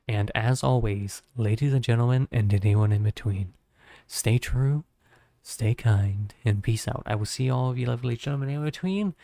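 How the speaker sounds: loud, measured sign-off announcement; streamer outro. Real, not acted. Streamer signing off to viewers at stream's end, announcer-like. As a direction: project each phrase, pausing between them.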